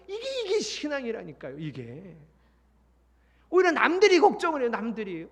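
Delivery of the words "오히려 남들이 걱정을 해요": emphatic. Real, not acted. Emphatic preaching.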